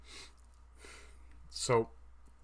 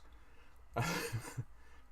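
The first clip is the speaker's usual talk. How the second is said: airy giggle; 3 semitones higher, 5 dB quieter than usual. Real, not acted. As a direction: laugh off the swear word. Laughs after the swear.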